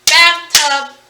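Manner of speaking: BATH and TUB punched loud, a beat each; syllable-by-syllable bathtub; clapped syllables. Shown as a demonstration. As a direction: clap out bathtub in two loud beats. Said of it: "do for syllables is clap".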